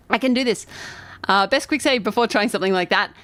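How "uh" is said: ordinary delivery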